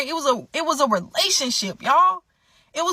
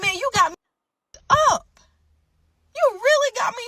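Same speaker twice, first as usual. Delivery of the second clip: stunned disbelief; 11 semitones higher than usual. Retold or imitated. She realises she was fooled.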